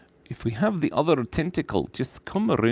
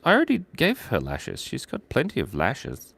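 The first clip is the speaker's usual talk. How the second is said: ordinary talk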